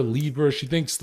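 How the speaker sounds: ordinary talk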